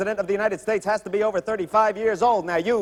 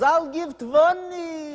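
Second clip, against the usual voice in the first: heckler from the back; 8 semitones higher, much slower than usual. Retold or imitated. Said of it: "A voice in the back yells".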